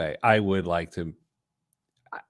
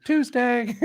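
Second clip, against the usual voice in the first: high breezy wife voice; 13 semitones higher than usual. Retold or imitated. Voicing wife deadpan.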